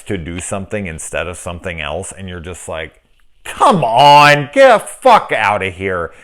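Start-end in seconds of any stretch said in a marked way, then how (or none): 3.4-6.1 exasperated yell. Retold or imitated. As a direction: come on shouted high and loud, then get the fuck outta here. Yelling at a dumb movie moment.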